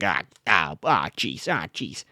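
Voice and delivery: low, mock commander grumbling; commander impersonation. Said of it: "some sort of commander".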